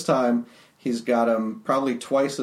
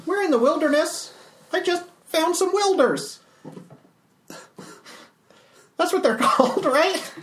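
high Calvin voice; 14 semitones higher than usual. Acted. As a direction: voice the little construct brightly.

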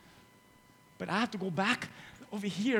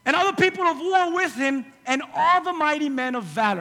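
loud, high preaching voice; 8 semitones higher, 8 dB louder than usual. Acted. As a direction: preach it at full force. Preaching at full force.

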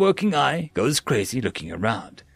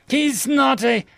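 sharp high angry cry; angry outburst; 11 semitones higher, 4 dB louder, slower than usual. Acted. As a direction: snap in rising anger.

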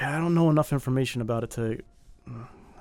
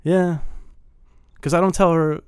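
ordinary talk

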